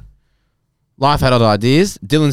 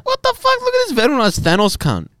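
excited disbelief. Real, not acted. Unboxing a surprise birthday gift.

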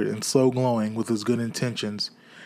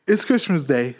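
delighted discovery; 4 semitones higher, 5 dB louder than usual. Acted. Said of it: "said Scrooge to himself".

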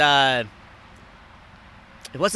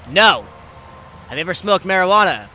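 louder, insistent hint; pointed loud repeat; 4 semitones higher, 8 dB louder than usual. Retold or imitated. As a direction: lean on the hint hard. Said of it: "He's like".

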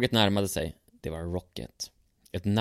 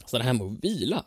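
ordinary delivery

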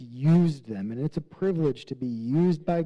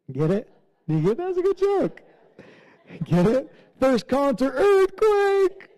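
much higher and louder, delighting in the pun; excited pun push; 11 semitones higher, 5 dB louder than usual. Real, not acted. Preacher to congregation, proud of his earthquake pun, gleeful. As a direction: sell the joke, grinning.

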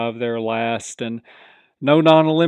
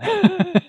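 short laugh; 5 dB louder than usual. Real, not acted. Laughs hoping for dissension.

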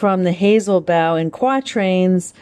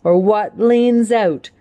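higher, lilting poetry reading; poem recitation; 5 semitones higher than usual. Acted. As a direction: perform the verse for the class, savouring each rhyme. Recites poem after title.

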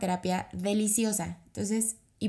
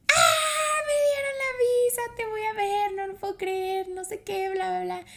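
crying with joy; 13 semitones higher than usual. Retold or imitated. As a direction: shout the news while sobbing happily. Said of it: "yo berreando así".

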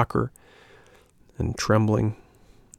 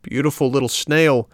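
calling out; 5 semitones higher than usual. A narrator's reading. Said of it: "cried Pinocchio from the street".

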